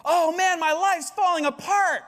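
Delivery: high whiny cry that everything is falling apart; mock despairing complaint. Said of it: "You know what I'm talking about?".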